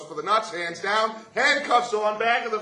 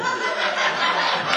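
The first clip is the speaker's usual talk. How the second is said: laughing; 10 semitones lower, 5 dB louder than usual. Real, not acted. Laugh mid-sentence.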